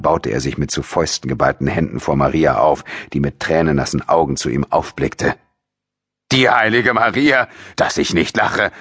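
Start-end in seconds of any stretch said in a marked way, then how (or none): 6.3-8.7 scornful father, starting high; snapping at her. A narrator's reading. Father mocking his daughter as a saint, angry scornful outburst. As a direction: sneer angrily at her. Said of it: "fuhr er sie an".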